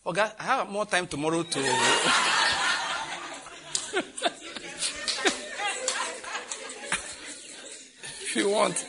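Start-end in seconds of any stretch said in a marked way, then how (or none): 1.6-8.2 high, long unvoiced laugh; long laughter. Real, not acted. Preacher laughs at own joke.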